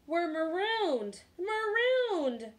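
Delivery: high, slow, drawn-out moan. A narrator's reading. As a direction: moan it in comic despair. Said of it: "moaned the others".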